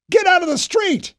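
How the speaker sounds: high, loud urgent shout; yelled warning. A narrator's reading. A soldier warns Knopf to get off the street, high loud shout. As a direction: bellow the order over the gunfire. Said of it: "someone yelled".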